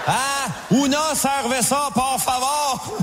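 slow, lazy tourist Spanish with a laugh; bad Québécois Spanish. Québécois tourist orders beer in Cuba, lazy slow Spanish. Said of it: "un Québécois qui parle espagnol".

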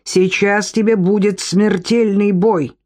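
plain reading voice

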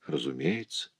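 very soft, near-whispered reply; hushed confirmation. Acted. Rudolph admits knowing her, hushed. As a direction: confirm it under your breath.

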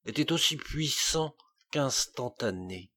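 plain reading voice

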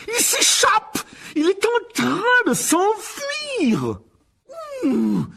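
outraged high-pitched bellow; full-force enraged uncle's yell; roaring. A narrator's reading. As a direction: roar the alarm, stretching it out. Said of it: "rugit l'oncle Vernon".

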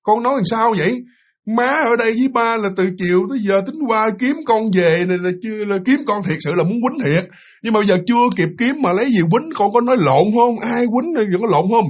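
lightly voiced old woman demanding answers from a child; a hint of a worried grandma questioning; grandmother scolding. Grandmother scolding the small boy.

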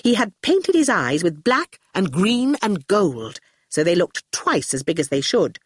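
plain reading voice

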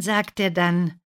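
ordinary delivery, nothing marked

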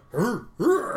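high playful bear huffs; playful bear grunts. Acted. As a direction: huff like an eager bear.

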